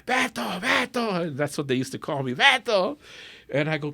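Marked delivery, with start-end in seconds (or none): 0.1-1.3 retold scream. Dad calling son from the back, screaming. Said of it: "I hear this screaming". 2.3-3.0 high, loud calling out of a name; retold scream. Dad calling son from the back, screaming. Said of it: "I hear this screaming".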